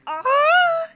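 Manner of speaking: very high, loud thrilled scream; excited screech. Acted. Escaping the car park with Darby chasing, excited screech. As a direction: let out a wild happy screech. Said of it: "you screeched with excitement".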